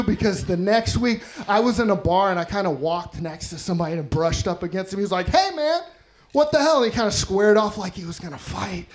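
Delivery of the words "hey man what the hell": higher-pitched mock affronted challenge; mock indignant. Bumped stranger in a bar confronts him, mock indignant. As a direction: imitate a guy squaring up, voice jumping up.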